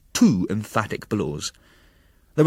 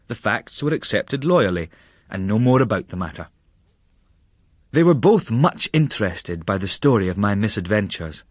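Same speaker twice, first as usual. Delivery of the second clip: plain reading voice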